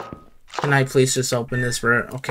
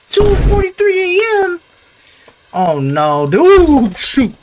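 much higher and louder alarmed cry; startled shriek; 6 dB louder, slower than usual. Real, not acted. Player seeing the clock jump, startled shriek. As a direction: yelp in shock.

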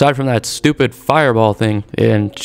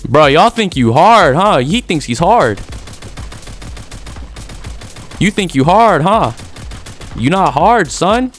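mock taunt; 6 semitones higher, slower than usual. Taunting an enemy player.

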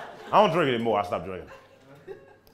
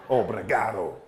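low, held-back comeback; mock menace; 6 semitones lower, 4 dB quieter than usual. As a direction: drop it low after the yelling. Answering the driver, menacingly low.

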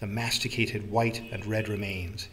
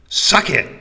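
sudden loud, high shout; shouted demand; 8 semitones higher, 14 dB louder than usual. Acted. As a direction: bark it out at full volume. Old man's demand climaxes.

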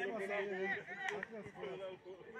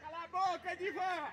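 shouting; 8 semitones higher, 10 dB louder than usual. Real, not acted. Yelling at a friend.

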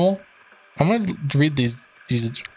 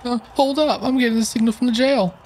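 high, fast alert voice; character voice; 10 semitones higher than usual. Acted. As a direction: squeak it out quick. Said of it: "nice voice acting stuff here".

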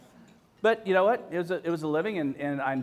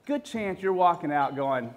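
ordinary talk, nothing marked

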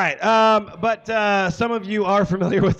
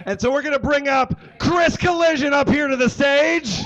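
raised and called out to the room; announcer intro; 8 semitones higher than usual. Real, not acted. Host calling the guest to the stage, announcer style. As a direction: bring him up like a host.

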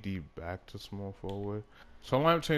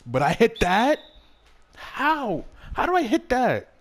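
squeaky, much higher outraged disbelief; 13 semitones higher, 9 dB louder than usual. Real, not acted. Gamer baffled by his own missed shot, high incredulous whine. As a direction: pitch way up, can't believe the shot missed.

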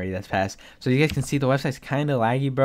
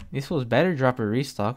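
ordinary delivery

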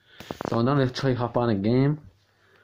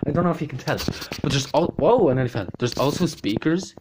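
excited; 3 semitones higher, 6 dB louder than usual. Real, not acted. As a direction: gush about it, overjoyed. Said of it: "I'm so excited".

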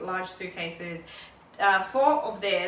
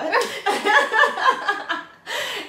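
loud high burst of laughter; 12 semitones higher, 9 dB louder than usual. Real, not acted. Laughing at her own failed Kirundi.

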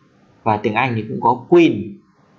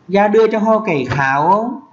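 câu tiếng Mường "Ra đưa cho ho cải kháo không?" lên giọng cao; đọc câu Mường cao và ngân; Mường phrase, raised; 8 semitones higher than usual. Shown as a demonstration. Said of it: "mày đưa cho tao cái lược". (giọng Mường cao, lên cuối câu)

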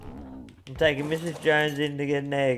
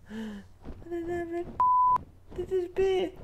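high, soft, breathless disbelief; shocked gasps; 12 semitones higher, 10 dB quieter, faster than usual. Real, not acted. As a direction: gasp as the egg vanishes. Gasp, egg disappeared.

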